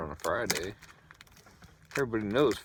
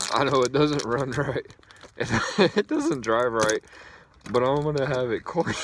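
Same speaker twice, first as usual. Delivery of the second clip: louder, laughing through the words; 5 semitones higher, 8 dB louder than usual. Real, not acted. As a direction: chuckle through the joke. Joking about his car.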